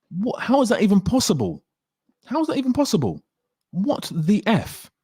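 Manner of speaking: high-pitched incredulous outburst; baffled, high-voiced protest; exasperated disbelief. Retells disbelief at bad effects.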